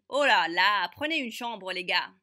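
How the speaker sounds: loud mocking call from the back; teasing shout. Classmate at the back teasing the pair, loud shout. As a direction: holler it across the room to tease. Quelqu'un au fond a lancé.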